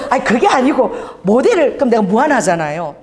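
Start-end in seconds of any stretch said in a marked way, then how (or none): 0.1-1.7 correcting him through a laugh. Retold or imitated. Starbucks barista correcting the pastor while laughing. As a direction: giggle the words out. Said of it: "막 웃으면서".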